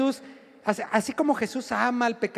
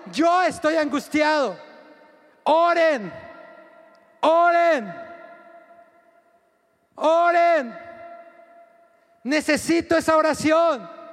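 loud, higher, pleading call to pray; urgent plea; 5 semitones higher, 4 dB louder, slower than usual. Retold or imitated. Jesus urgently shouting to his disciples to pray, much louder. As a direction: cry out for prayer with urgency. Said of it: "Jesús les dijo".

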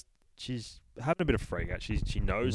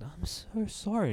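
high, slow, eerie ghostly voice; creepy voice; 11 semitones higher, 3 dB quieter than usual. Acted. A ghost outside the bathroom speaks, high and eerie. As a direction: float it out like a ghost apologising. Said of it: "this creepy voice from the outside".

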